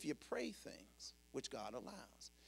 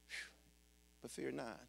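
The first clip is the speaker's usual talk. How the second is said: ordinary delivery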